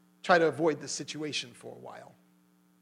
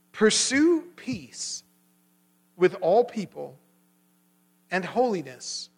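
slow, emphatic verse reading with long pauses; weighty, drawn-out pulpit reading; scripture reading; 3 semitones higher, 6 dB louder, much slower than usual. Real, not acted. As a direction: proclaim each phrase deliberately. Reads a Bible verse aloud.

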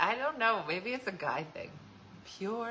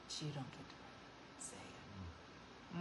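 much softer, much lower menacing hush; low warning; 9 semitones lower, 18 dB quieter, slower than usual. Retold or imitated. Sister warning an outsider who insulted her sibling, low menacing hush. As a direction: drop to a quiet threat.

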